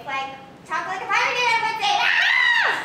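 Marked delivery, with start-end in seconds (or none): 2.0-2.8 sudden high squeal of excitement; excited shriek. Real, not acted.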